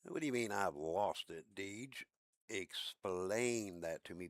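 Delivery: ordinary delivery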